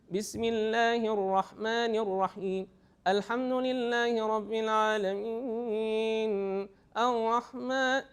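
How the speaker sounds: over-nasal recitation. Shown as a demonstration. Teacher imitating a student with a nasal problem, over-nasal chant. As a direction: imitate chanting only through the nose. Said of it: "he's only using his nose".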